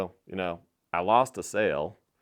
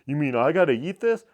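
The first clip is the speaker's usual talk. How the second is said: higher, louder mock-indignant customer; 5 semitones higher, 7 dB louder than usual. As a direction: ham up the customer's outrage, pitched up and loud. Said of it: "he's just like".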